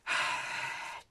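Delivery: long unvoiced sigh of exasperation; exasperated sigh. Patient sighing at the useless nurse, exasperated. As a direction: blow out a long frustrated breath.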